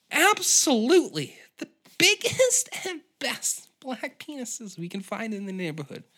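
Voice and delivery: Sally chirping with pride; proud waitress. Acted.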